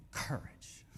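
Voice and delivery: soft, rough growl on one word. Acted. Preacher echoing the Wizard of Oz for the crowd, growled. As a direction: growl it under your breath. Repeats word, alluding to Cowardly Lion.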